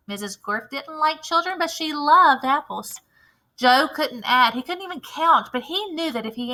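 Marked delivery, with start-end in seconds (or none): none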